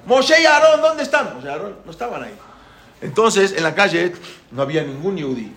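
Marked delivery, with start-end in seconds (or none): 0.1-1.3 high, loud searching call. Retold or imitated. Pharaoh looking for Moshe and Aarón, shouting. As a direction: holler the names down the street. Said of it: "empezó a gritar".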